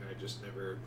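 ordinary talk, nothing marked

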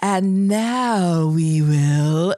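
slow, low affected voice; little voice. Retold or imitated. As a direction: drag out a pretentious little voice. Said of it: "Like, little voices".

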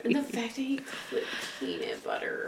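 whispered in disgust; whispering. Real, not acted. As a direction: hush it out, appalled. Disgusted whisper about letter.

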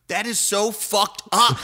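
loud, high, drawn-out outrage; slow, punched retort of a pranked roommate; imitated angry roommate. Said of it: "he was mad at me".

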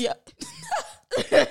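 very high laughing squeak; squeaky high yeah. Retold or imitated. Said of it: "I said".

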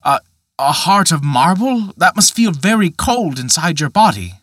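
put-on a young man too startled to speak clean; stuttering disbelief. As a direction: stumble on the first sound in shock. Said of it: "said Peter, stuttering".

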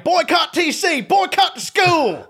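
hammed-up Virginia small-town holler, high and slow; Virginia townsfolk accent. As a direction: drag it out in a high-pitched, mocking Virginia yell. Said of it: "They talk exactly like that".

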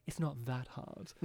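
soft, low, slow, refined aside; elegant quip. Acted. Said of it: "quips very, very elegantly".

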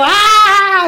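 high laughing "ah!" of glee; gloating laughing yell at the teacher who crashed; mocking laugh shout. Mocks the teacher after his crash.

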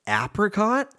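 Apricot squeaked up high in disbelief; Apricot with short a, pitch leaping high; shocked disbelief. Real, not acted. Realizing he said it wrong for years, squeaking in shock. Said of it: "I've been saying it wrong".

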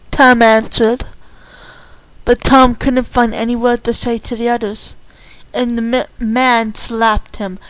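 ordinary delivery

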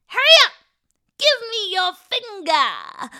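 loud, high demand, stretched out at the end; impatient old woman. Acted. Old woman demanding the boy's finger, loud and impatient.

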